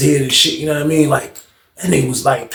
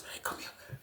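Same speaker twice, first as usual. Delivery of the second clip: urgent whisper to a cellmate; secretive urgent whisper; 31 dB quieter than usual. Retold or imitated. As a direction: hiss it so no one hears. Calling cellmate over secretly.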